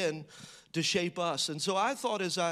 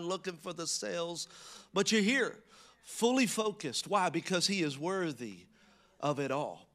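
ordinary talk, nothing marked

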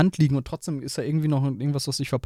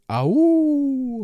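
sudden high yelp of pain; getting a leg cramp; 12 semitones higher, 3 dB louder than usual. Real, not acted. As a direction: cry out as a cramp hits. Sudden pain mid-sentence.

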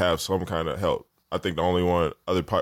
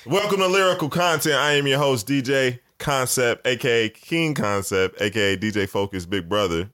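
show host intro; 6 semitones higher than usual. Acted. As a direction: announce the show like a DJ.

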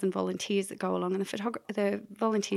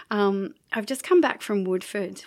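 ordinary talk, nothing marked

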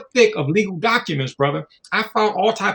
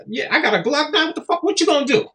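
mock gangster; 8 semitones higher than usual. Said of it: "Because they are gangsters".

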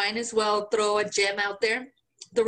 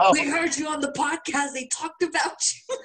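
higher, joking imitation of a listener; mock stranger; 5 semitones higher than usual. Host joking someone would go get her, mock voice. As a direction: put on a higher voice for a pretend stalker.

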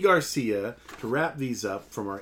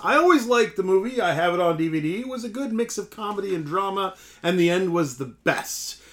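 high, put-on American accent reading; imitated American accent; 5 semitones higher, 3 dB louder than usual. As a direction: read it out like an American, pitched up. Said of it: "he puts on an American accent".

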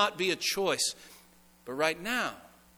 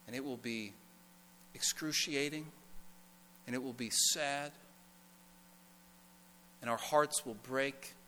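tender quiet preaching; 3 semitones lower, 8 dB quieter than usual. Acted. Soft consoling preaching.

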